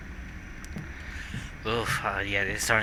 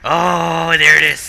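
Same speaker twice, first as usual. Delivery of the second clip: excited; 6 semitones higher, 11 dB louder, slower than usual. Real, not acted. Finding the missing key.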